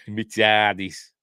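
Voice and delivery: slow, drawn-out mock Yorkshire; Yorkshire accent. Host still joking as Yorkshire Doctor, drawn-out Yorkshire accent. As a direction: put on a broad Yorkshire Doctor. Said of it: "should have had a Yorkshire accent".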